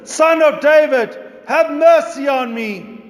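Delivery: loud, high pleading cry; calling out. Acted. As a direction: cry out to be heard over the crowd. Blind beggar calling out to Jesus.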